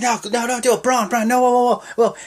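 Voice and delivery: high, loud mock panic; panicked pleading. Bystanders begging Braun not to push the dumpster, high panicked pleading. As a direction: beg him to stop, voice flying up.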